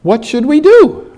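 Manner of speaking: high, loud, worried disciple; fretting high and loud; anxious disciple voice. Retold or imitated. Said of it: "想像得到佢哋話係".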